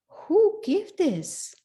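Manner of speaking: slow, high-pitched, wondering question; who on earth could it be; astonished question. Retold or imitated. Acts out a master's astonished question.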